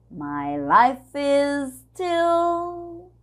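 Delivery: "My life is still" run together as "life-is-still", very slow; slow sung demo of the linking; linked words. Shown as a demonstration. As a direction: join life and is in one breath. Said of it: "a gente vai unir o life is".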